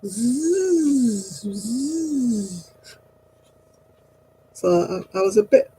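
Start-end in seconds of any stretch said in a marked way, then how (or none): 0.0-2.7 high, drawn-out snake hiss; playful imitation of a hissing cartoon snake. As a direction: hiss like the Jungle Book snake. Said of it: "And it goes".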